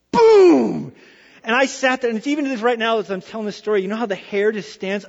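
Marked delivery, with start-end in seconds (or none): 0.1-0.9 loud, high, drawn-out boom; imitated orchestra blast. Preacher demonstrates the unforgettable sound, explosive imitated blast. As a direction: burst out like the first note. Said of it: "It was like this".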